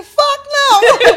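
high, loud laughing shout. Retold or imitated. Recalling refusing to swim, laughing shout. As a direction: yell it out laughing. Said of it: "I said".

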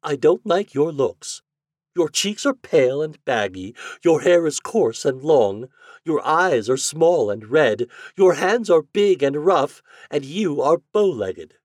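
high, loud, disdainful lady's voice; cutting rejection in a high feminine character voice; haughty lady. A narrator's reading.

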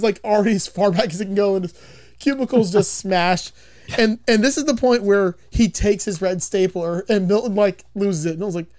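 usual speaking voice

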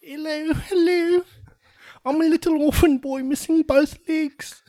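put-on little orphan boy. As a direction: pipe up like a scrappy little street kid.

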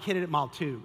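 ordinary delivery

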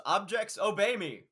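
commanding rich man. Retold or imitated. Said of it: "And also he says".

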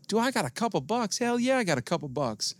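put-on high, eager drunk bravado; drunk enthusiasm. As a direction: puff up like a generous drunk. Drunk self answering homeless man.

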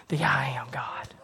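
whispered awe; near whisper. Acted. Preacher whispering God's name to the church. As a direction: breathe it out, almost voiceless. Hushed climax of sermon.

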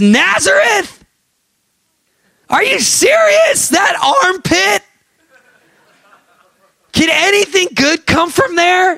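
very high-pitched incredulous mock shout; scornful disbelief. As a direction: squeal each question in exaggerated disbelief. Nathanael scoffing in disbelief at Nazareth.

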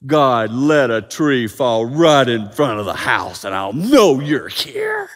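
loud plea, stretched out at the end; dramatic plea. Acted. Believer begging God for big stuff, dramatic plea. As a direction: call up to God for a big sign. Acted demand for big signs.

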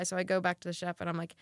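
normal talking voice

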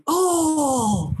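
high, sudden gasp of a crowd; mock crowd cry of shock; crowd's shocked "Oh!". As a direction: whoop like a stunned crowd watching a slap. Crowd reacts to the slap.

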